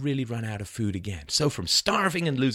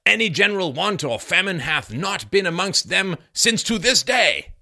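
loud, higher-pitched declamation; declaimed historical quote; 8 semitones higher, 6 dB louder than usual. Acted. Reading Bradford's account to listeners, loudly declaimed. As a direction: proclaim it slowly and grandly.

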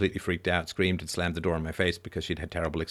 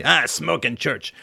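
hammed-up Quebec French accent, higher and slow; a slow, higher jokey Quebec French put-on; mock Quebec accent; 6 semitones higher, 4 dB louder, slower than usual. Mocking his own slip into Quebec, in a hammed-up Quebec French accent.

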